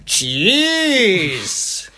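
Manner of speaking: cheese hugely drawn out, the Welsh way; Welsh-style pronunciation. Acted. Ordering at Subway, cheese in exaggerated Welsh style. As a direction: stretch cheese into a long exaggerated word. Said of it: "I pronounce it in the Welsh way".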